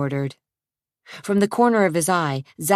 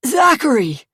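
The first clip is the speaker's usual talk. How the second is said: high, loud cry of his name; insisting shout; 9 semitones higher, 6 dB louder than usual. Acted. Bloody Zach shouts his name at the thugs, insisting. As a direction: spit out your own name.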